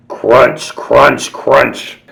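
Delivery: loud chewy crunch crunch. Acted. Storyteller voicing the Nombies eating: loud crunching. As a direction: crunch it out loudly.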